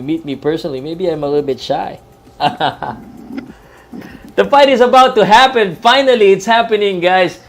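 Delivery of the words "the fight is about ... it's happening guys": much higher and louder, bursting with hype; excited. Real, not acted. Commentator telling viewers the fight is finally starting, excited and loud. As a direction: announce it like the bell just rang.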